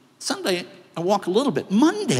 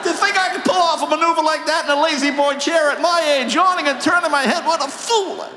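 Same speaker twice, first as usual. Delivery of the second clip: pain yell; 12 semitones higher than usual. Retold or imitated. Screaming in pain to wife.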